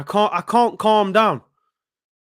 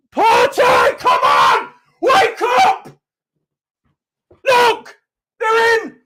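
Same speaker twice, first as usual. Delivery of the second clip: frustrated yelling; 11 semitones higher, slower than usual. Real, not acted. Fan screaming at Partey as the opponents break through, real alarm. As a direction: shout a warning as danger comes.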